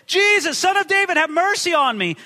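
high-pitched pleading cry. A narrator's reading. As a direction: call out for mercy over a crowd. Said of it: "he cried out".

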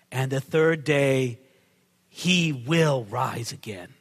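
low, very slow and weighty; hushed-low solemn declaration; solemn promise. Acted. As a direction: deliver it gravely, word by word. Solemn climax of prophecy.

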